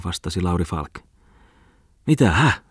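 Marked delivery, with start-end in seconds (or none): 2.1-2.6 startled. A narrator's reading. Bookseller startled by rain.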